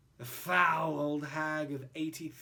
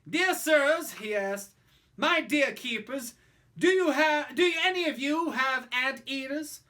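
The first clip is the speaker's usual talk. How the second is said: pompous father; 7 semitones higher, 10 dB louder than usual. Acted. Father writes to zoos.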